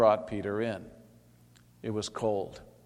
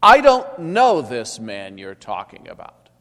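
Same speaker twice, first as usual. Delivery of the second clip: much louder, cursing denial; sworn denial; 14 dB louder than usual. A narrator's reading. Peter swearing to those around him, loud. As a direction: swear it at them loudly. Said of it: "he swore to them".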